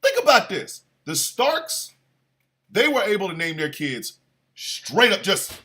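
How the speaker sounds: ordinary talk, nothing marked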